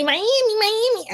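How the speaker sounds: high mocking imitation of overexcited fans; mocking everybody. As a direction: mimic the crowd's excitement in a high voice.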